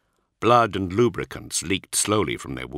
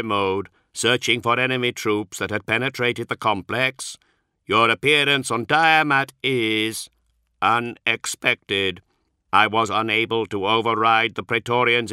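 a polite machine reciting its excuses; synthetic Magos voice; 4 semitones higher, 4 dB louder than usual. Acted. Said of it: "his synthetic voice more carefully modulated now".

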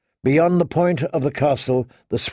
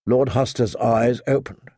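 ordinary delivery, nothing marked

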